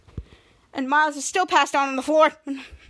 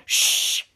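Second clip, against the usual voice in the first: long voiceless shush; hushing shh. Retold or imitated. Chopper silencing Ray, acted hush. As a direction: cut someone off with a firm "shh".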